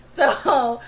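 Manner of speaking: ordinary talk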